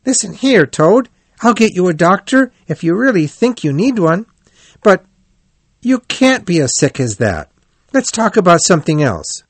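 high, insistent storybook friend; concerned Rat. A narrator's reading. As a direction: reason with the patient in a lifted voice.

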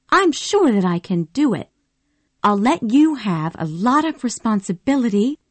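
a high, insistent Southern belle; eager Scarlett. Acted. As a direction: pitch it up and press him hard. Scarlett voiced by narrator.

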